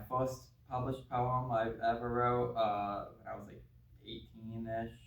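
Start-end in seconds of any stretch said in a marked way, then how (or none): none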